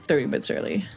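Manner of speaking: normal talking voice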